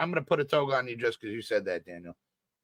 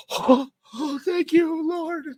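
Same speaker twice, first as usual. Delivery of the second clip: very high, breathless thanks to the Lord; overwhelmed believer; much slower than usual. Pretend. Forgiven stranger thanking Jesus, squeaky and overwhelmed. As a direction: swoon with grateful relief, up high. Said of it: "They'd be like".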